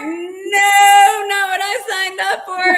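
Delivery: high, loud, hammed-up panic cries; panicked shrieking. Doubting humans panicking mid-takeoff, hammed-up shrieks. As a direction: shriek like a frightened astronaut aborting a launch. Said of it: "they're like".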